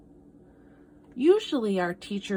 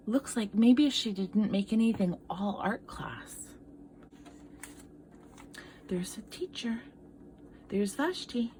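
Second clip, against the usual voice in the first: hushed whisper over the picture; whispered aside; 5 dB quieter than usual. Real, not acted. Teacher whispering to children about the picture.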